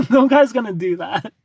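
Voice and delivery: high, loud through laughter; amused, laugh-filled disbelief. Real, not acted. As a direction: crack up while dismissing the idea. Laughing at own acted scenario.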